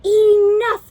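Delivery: a loud fed-up cry; exasperated cry. Acted. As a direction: throw it out in exasperation. Exhausted shape exclaims.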